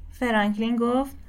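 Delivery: usual speaking voice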